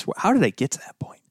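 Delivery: half-amused whisper wondering how he got here; whispered aside. Real, not acted. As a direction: mutter it under your breath as a puzzled aside. Aside to himself mid-tangent.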